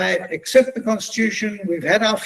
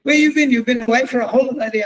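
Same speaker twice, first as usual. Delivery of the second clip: sharp, louder and higher, fast; an angry colleague snapping; fuming; 5 semitones higher, 4 dB louder, faster than usual. Retold or imitated. Fuming colleague demanding where he went, sharp and loud. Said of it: "Glynis is fuming".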